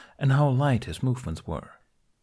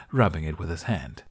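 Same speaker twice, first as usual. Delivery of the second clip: plain reading voice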